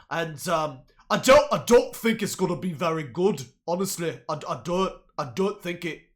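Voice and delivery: a hint of a British accent. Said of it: "Do a British accent".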